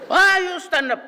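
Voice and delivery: high shrill scolding shout, half laughing; shrill angry professor. Retold or imitated. Old law professor scolding a student in a shrill high voice. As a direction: bark the order in a piercing high voice. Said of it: "ang boses nito... shrill".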